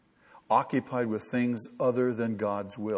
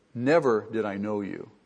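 Never leaned on at the very start; front-load the weight on Never; Never carries the stress, the rest falls; emphasis on Never; 3 semitones lower than usual. Shown as a demonstration. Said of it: "The emphasis in the Greek sentence is always in the first part".

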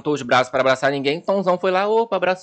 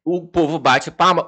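ordinary talk

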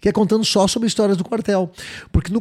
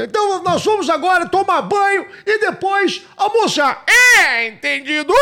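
sergeant shout; 10 semitones higher than usual. Retold or imitated. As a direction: yell orders like a sergeant. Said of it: "o sargento falava assim". (imitando o sargento gritando ordens)

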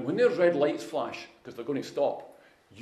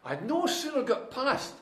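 ordinary talk, nothing marked

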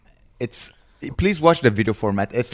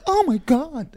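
high mock gasp of amazement; much slower than usual. Mocking an amazed reaction to a bouquet, high mock gasp. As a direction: gush it like someone dazzled. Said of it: "not like".

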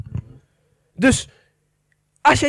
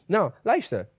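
normal talking voice

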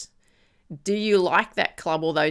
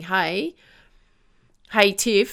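the nagging problem calling out; 3 semitones higher, 4 dB louder, slower than usual. Pretend. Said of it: "It keeps saying".

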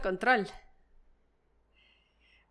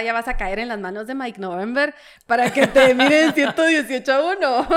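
laughing; 3 semitones higher, 7 dB louder than usual. Real, not acted. Joking about controller, laughs.

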